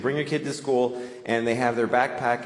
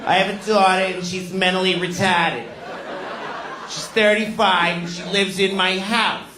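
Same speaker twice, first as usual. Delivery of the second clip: Boston teacher; 5 semitones higher than usual. Retold or imitated. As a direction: announce it like a brassy teacher. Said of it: "She used to tell us every day".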